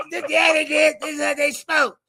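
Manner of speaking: high, loud mock insistence; hammed-up defensive reply; insistent defender. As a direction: insist in a high-pitched put-on voice. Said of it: "I said".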